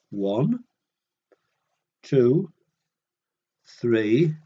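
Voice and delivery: usual speaking voice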